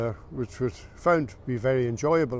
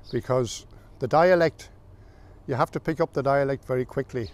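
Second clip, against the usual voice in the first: ordinary talk, nothing marked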